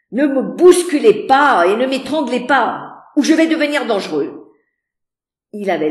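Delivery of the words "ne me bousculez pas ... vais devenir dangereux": loud, fast, half-joking threat; gruff warning. A narrator's reading. Rochester warning the clinging ladies, loud gruff threat. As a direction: push the clinging ladies off with a growl.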